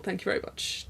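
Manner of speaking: hushed mock speaker sign-off; mock talk ending. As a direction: close a pretend lecture softly. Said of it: "This is my egg talk".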